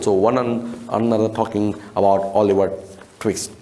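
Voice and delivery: ordinary talk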